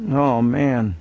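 sag like all the air went out; deflated groan. Retold or imitated. Deflated after a guilt-trip sermon.

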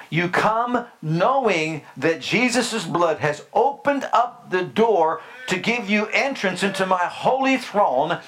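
ordinary talk

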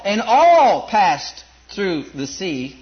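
"all" held long, whole line very slow; stress on all. Shown as a demonstration. Preacher stressing all for the congregation. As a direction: drag out "all" to hammer it home. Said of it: "emphasizing the word all".

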